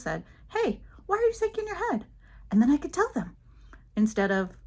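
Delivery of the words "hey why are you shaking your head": high-pitched puzzled query; confused questioner. Pretend. Imagined adult asks about her head shaking, confused and high. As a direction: play someone honestly baffled. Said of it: "like, confused".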